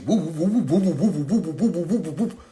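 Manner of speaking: rapid looping siren whoops; high wobbling vocal siren; imitated police siren. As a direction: whoop up and down like a squad car siren.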